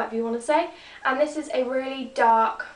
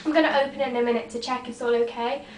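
ordinary talk

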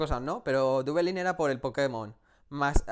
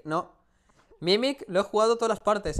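ordinary delivery

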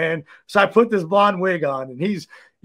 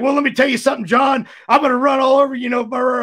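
shouted, higher wrestler promo voice; wrestling promo; 5 semitones higher than usual. Retold or imitated. Cutting an improvised promo on the record label owner, shouting. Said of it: "I just cut a promo".

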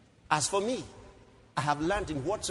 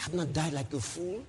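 normal talking voice

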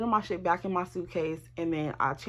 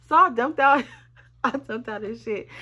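laughing; 3 semitones higher, 4 dB louder than usual. Real, not acted. Laughing while telling it.

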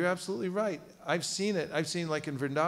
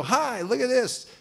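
hi stretched out, higher and louder; bright greeting; 8 semitones higher, 5 dB louder than usual. Retold or imitated. Devotee greeting a householder at the door, eagerly. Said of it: "you go".

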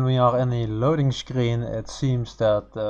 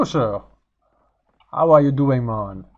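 usual speaking voice

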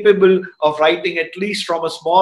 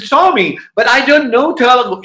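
put-on higher-pitched protest of a young boy; acted helpless objection, pitched up; retold protest; 5 semitones higher than usual. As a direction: imitate a boy objecting to his master.

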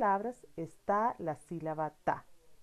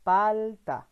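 louder, clear model word; model word for repeating; 6 dB louder than usual. Shown as a demonstration. Teacher to child, loud model word to repeat. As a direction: say it out for the child to copy. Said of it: "Repite conmigo".

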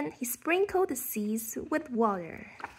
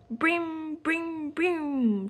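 playful shout, a little higher; shouted brim brim; 5 semitones higher, 3 dB louder, much slower than usual. Acted. Posy shouting her game noise near the snail, playful shout. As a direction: yell it like a noisy game. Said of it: "she shouted".

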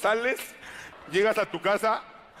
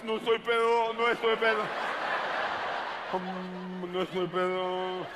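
fake sober drunk; 2 semitones lower, slower than usual. Drunk insisting he's sober.

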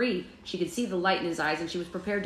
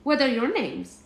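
put-on high German little-boy voice; friendly child with a mock German accent; cute German accent; 8 semitones higher, 4 dB louder than usual. A narrator's reading. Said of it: "in a cute German accent".

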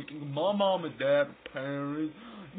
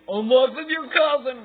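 loud punchline; 9 semitones higher, 9 dB louder than usual. Pretend. Repeated punchline louder.

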